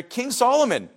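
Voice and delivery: usual speaking voice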